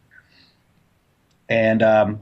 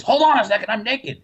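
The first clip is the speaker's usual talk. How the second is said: startled neighbor; 10 semitones higher than usual. Retold or imitated. Neighbor calls out from kitchen.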